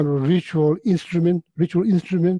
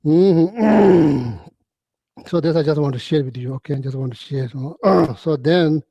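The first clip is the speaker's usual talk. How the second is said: ordinary talk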